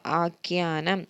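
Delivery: ആഖ്യാനം said slowly, leaning on the ഖ്യ cluster; careful spelling-out. Shown as a demonstration. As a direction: stretch ആഖ്യാനം so the aspirated ഖ്യ is clear. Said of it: "നഖത്തിൻ്റെ ഖ". (ആഖ്യാനം പതുക്കെ, ഖ്യ വ്യക്തമാക്കി)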